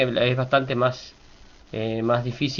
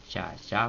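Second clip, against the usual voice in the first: "Ya, ya" low and soft; two soft, low-toned beats on "Ya, ya"; low accent; 3 semitones lower, 8 dB quieter than usual. Shown as a demonstration. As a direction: drop both "Ya, ya" below the talking pitch. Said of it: "acento bajo".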